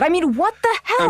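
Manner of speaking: excited. Acted. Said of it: "I'm talking like Jenny when she gets excited".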